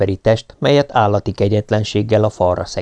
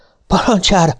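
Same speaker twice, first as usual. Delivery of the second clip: panting; 9 semitones higher than usual. A narrator's reading.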